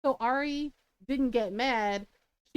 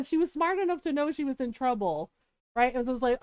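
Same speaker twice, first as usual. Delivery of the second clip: ordinary talk, nothing marked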